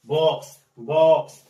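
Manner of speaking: बॉक्स with a short open 'aw' vowel, said loudly. Shown as a demonstration. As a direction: punch out बॉक्स twice, vowel open as in 'hot'. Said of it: "अ करके पढ़ते हैं". (बॉक्स में खुली छोटी ऑ की आवाज़, ज़ोर से)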